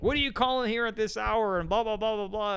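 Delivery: high-pitched mock lecture; lecturing customer. Irate customer lecturing a telemarketer at this hour, mock scolding. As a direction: imitate an indignant customer, pitched well up. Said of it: "like, lecture you".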